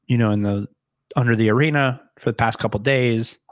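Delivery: ordinary talk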